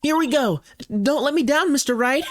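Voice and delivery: put-on high, perky girl voice. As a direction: cheer him on, high. Voicing the assistant.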